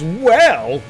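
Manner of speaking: loud, high-pitched burst on one word; mock shout. Reading a viewer's chat message aloud, mock shout. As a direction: shout it as if typed in capitals. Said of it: "no need to shout in the chat".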